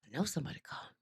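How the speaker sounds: very soft, whispered thought; hushed self-talk. Retold or imitated. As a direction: whisper it privately, as if retelling a secret thought. Retold unease at hearing name.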